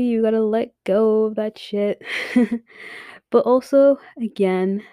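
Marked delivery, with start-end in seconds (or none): none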